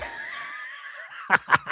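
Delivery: very high monkey screech of laughter; high-pitched chimp cackle. Acted. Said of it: "It's monkey impression".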